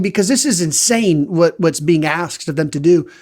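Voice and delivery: ordinary delivery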